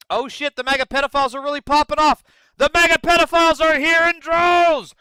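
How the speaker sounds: fast, loud hype at the chat; excited shout. Real, not acted. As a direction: announce the raid at full volume. Trolls flooding the chat.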